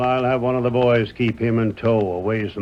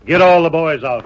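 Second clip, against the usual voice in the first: loud, higher command; shouted order; 6 semitones higher, 9 dB louder than usual. Acted. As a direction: bark it to the whole ranch. Boss orders a search.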